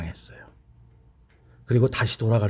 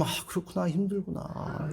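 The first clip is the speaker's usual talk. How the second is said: gentle sympathy; 5 semitones higher, 11 dB quieter than usual. Retold or imitated. A pastor softly comforting a sister in pain, tender. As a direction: murmur it tenderly to a hurting friend.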